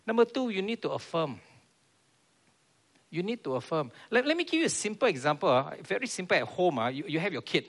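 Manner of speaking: normal talking voice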